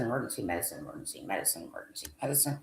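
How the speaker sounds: low, soft repeated muttering; counting under breath. Real, not acted. Speaker going down repeated list entries, muttering to himself. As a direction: let it drop to a low mumble as you read down.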